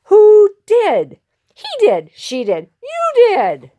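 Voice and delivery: high, jumpy finger-pointing voices; squabbling accusations. Acted. Crowd accuses each other.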